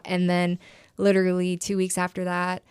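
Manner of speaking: ordinary delivery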